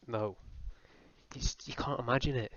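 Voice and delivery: ordinary talk, nothing marked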